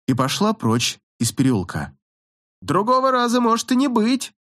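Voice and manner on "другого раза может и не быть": high call after someone leaving. A narrator's reading. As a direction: call after her down the alley. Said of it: "прокричал Джонни вслед".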